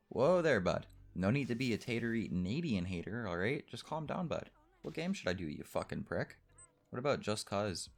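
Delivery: playing a cartoon Canadian, pitch up; mock Canadian. Telling off his friend about Canadian haters, in a high hammed-up Canadian accent.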